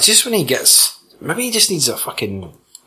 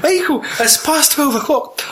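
high mock scolding voice; a high, playful telling-off; 14 semitones higher than usual. Someone scolds late Michael.

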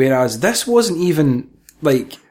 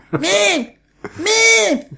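put-on much higher, yelled "Mom! Mom!" like a whiny boy; mock kid calling mom. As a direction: call for mum like a sly wee kid. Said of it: "He's like".

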